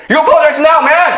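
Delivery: a retold bully pushing hard for an apology; angry demand. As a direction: bark it at the new kid like a playground bully.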